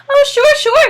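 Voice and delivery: placating serviceman. A narrator's reading. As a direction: humor him while backing away. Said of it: "said the serviceman".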